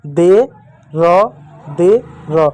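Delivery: दे and र said apart; syllable by syllable. Shown as a demonstration. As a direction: sound out the parts. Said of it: "तो एक साथ बोलो". (अक्षर अलग-अलग बोलना)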